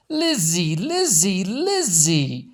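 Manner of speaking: a lightly high sing-song greeting; gushing Pearline. A narrator's reading. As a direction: call her name with rising delight.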